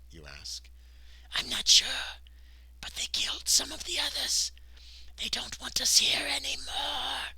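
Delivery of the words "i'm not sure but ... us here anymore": high, strained stage whisper; anxious whisper. A narrator's reading. Frightened worker telling the player of killings, anxious whisper. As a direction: rasp it anxiously, rising and stretching the end. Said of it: "he says anxiously".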